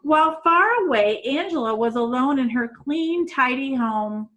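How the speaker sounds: ordinary delivery, nothing marked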